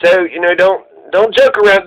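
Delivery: ordinary talk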